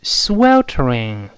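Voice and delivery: "sweltering" spoken very slowly and clearly for the class to copy; drawn-out "sweltering" model; slow model reading. Shown as a demonstration. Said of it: "再读一下".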